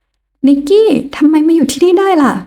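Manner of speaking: startled rival turning sweet. A narrator's reading. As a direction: caught off guard, sweetening her voice. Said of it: "ทำให้เธอสะดุ้งสุดตัว".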